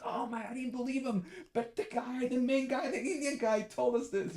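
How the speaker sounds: high-pitched imitation of an amazed friend; amazed gushing. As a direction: gush in a put-on high voice. Imitating celebrity friend on talk show.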